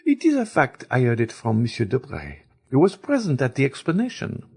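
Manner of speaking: a hint of a gossiping baroness; Baroness speaking. A narrator's reading. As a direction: Baroness assures her friend, lightly voiced. Baroness voiced in the story.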